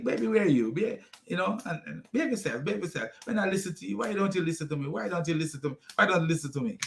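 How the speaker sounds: a soft, pleading little scold instead of a beating; mock gentle scolding. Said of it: "Just barely touching you. Like".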